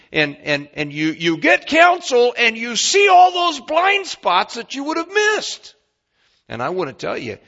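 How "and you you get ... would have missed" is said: high, loud, slow preacher's shout; preaching at full force. Acted. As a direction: lift the room on it. Climax of the point.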